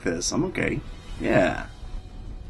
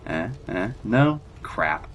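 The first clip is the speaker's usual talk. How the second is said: ordinary delivery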